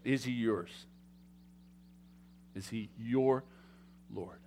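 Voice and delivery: usual speaking voice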